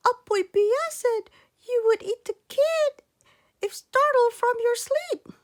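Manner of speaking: put-on high, loud boy voice explaining; a small boy blurting out the warning; boy telling the eagle, high and loud; earnest boy. Boy explains his fear.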